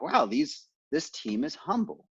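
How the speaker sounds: wow pitched high, then settling; mock amazed praise; lightly acted pleased surprise; impressed wow. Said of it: "we really were like".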